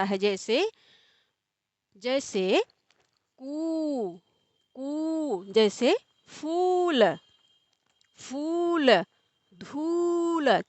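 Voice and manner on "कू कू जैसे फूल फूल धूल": ऊ stretched long in कू, फूल, धूल; long drawn vowel. Shown as a demonstration. Teacher showing the long ऊ to students, drawn-out vowel. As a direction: draw out the ऊ slowly in कू फूल धूल. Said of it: "खींच कर बोला जाता है".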